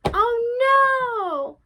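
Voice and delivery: high, loud cry of fright; frightened cry. Acted. Grandmother as the wolf swallows her, a loud frightened cry. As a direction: cry out in terror.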